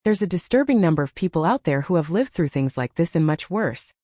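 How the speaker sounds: ordinary delivery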